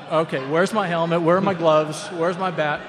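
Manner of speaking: a rookie suddenly sent to bat, shouting for helmet and gloves; frantic scramble. Retold or imitated. Rookie scrambling for his gear.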